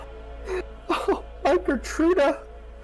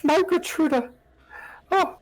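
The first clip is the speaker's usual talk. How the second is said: much higher, tearful pleading; begging mother; 11 semitones higher than usual. Acted. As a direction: beg everyone for help through tears. Mother begging for lost child.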